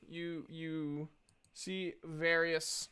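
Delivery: normal talking voice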